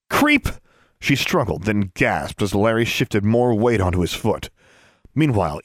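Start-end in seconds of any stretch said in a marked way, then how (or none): 0.1-0.5 high-pitched insult thrown out; angry outburst. A narrator's reading. Girl pinned by a bully insults him, angry outburst.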